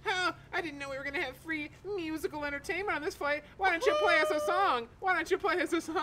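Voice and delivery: high, put-on pestering voice; mock a chirping stranger begging for a tune; nosy passenger. Plane passenger asking a guitarist for a song, high mocking voice. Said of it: "said to me in a different tone of voice".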